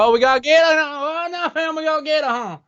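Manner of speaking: very high, whooping mock Cajun; Cajun accent. Cajun gator hunter crowing over a catch, high Cajun whoop. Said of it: "Cajun gators fishing".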